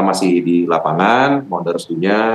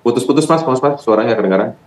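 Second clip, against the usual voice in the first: raised voice over bad line; 5 semitones higher than usual. Real, not acted. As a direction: call out to be heard on the phone. Bad phone line, calling out. (suara dinaikkan karena sambungan putus)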